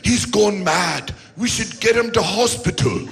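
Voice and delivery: put-on clumsy British accent announcing the news; bad British accent. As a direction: do a poor posh British newsreader. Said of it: "your British accent is getting worse".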